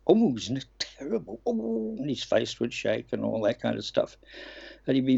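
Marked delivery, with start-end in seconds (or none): 0.1-2.0 high, breathy-free camp moan to camera; a fair Frankie Howerd moan, rising high on the last oh; Frankie Howerd impression. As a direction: sigh it out like a comic grumbling to the lens. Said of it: "how he used to address the camera".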